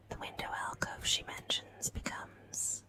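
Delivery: usual speaking voice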